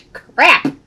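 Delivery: laughing through the words. Real, not acted. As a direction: giggle while you talk. Brush mishap, laughing.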